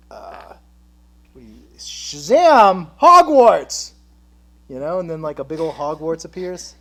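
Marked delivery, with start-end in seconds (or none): 1.8-3.9 loud, slow shout; magic incantation. Pretend. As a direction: boom it like a spell. Magic spell.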